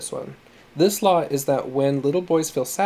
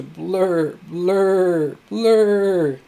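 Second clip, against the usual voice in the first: long, loud bawls rising in pitch; narrator's drawn-out mock wailing; imitated sobbing wail; 5 semitones higher, 5 dB louder, much slower than usual. A narrator's reading. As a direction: build each cry higher and louder. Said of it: "he cried".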